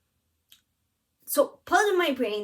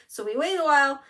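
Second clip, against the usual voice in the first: ordinary delivery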